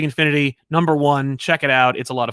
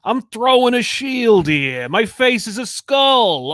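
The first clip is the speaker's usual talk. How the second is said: lightly hammed-up New York tough guy, slow and higher; mock New York accent; 7 semitones higher, 5 dB louder, slower than usual. Mock New York accent for a Marvel villain. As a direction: drawl it like a cartoon New Yorker, louder. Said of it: "a New York accent is".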